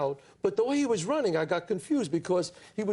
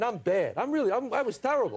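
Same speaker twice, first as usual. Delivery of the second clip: usual speaking voice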